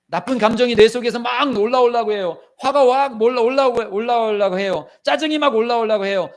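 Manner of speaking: high, loud, driving preacher's voice; preaching at full force. Acted. Preacher urging the congregation, high and loud. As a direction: press the point hard from the pulpit.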